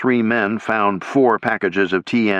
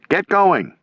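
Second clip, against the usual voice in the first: shouted; 10 semitones higher than usual. A narrator's reading. Soldiers shouting encouragement to one another. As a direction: shout it to the next man. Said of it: "they shouted at one another".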